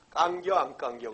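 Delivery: slow, loud Chungcheong dialect. Shown as a demonstration. Lecturer jokingly shortening the pea-pod phrase, Chungcheong dialect. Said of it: "충청도 말로 하면".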